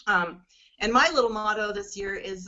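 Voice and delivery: ordinary delivery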